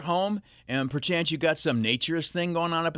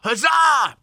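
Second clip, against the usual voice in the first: loud, high hammed-up cheer; mock huzzah cheer; 10 semitones higher, 10 dB louder than usual. Host mocks rowdy old-time crowds, cheering loud. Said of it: "those who yelled".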